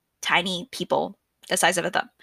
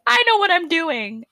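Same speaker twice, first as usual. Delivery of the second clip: put-on cocky kid; 10 semitones higher, 4 dB louder than usual. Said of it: "I was like".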